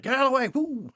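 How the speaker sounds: high, loud yell to clear the way; high-pitched, loud "woo" as he barrels through; retold yell. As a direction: shout people out of the way. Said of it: "I start yelling".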